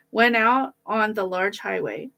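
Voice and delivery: usual speaking voice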